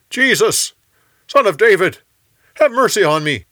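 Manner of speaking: high, loud cry for help; yelling. A narrator's reading. Blind beggar yells to Jesus over the crowd, loud high cry. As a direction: shout to be heard over the crowd. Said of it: "he yelled".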